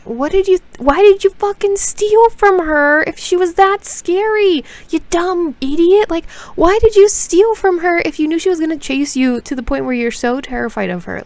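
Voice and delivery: high, loud exasperated rant at a character; furious disbelief at the heroine; exasperated yell. Acted. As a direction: scold the foolish heroine at full volume. Yelling at the book character.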